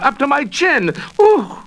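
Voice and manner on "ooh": high squealing ooh of a dunking; startled ooh. A narrator's reading. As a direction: shriek it as you hit the water. Splashed into scummy water.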